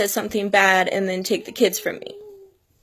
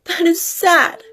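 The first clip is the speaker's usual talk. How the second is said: high, tearful voice breaking through the words; heartbroken, crying while speaking to viewers; 5 semitones higher than usual. Real, not acted. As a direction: talk through real tears, voice pinched high. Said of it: "I'm sorry for crying".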